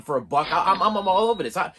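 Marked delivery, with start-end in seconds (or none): none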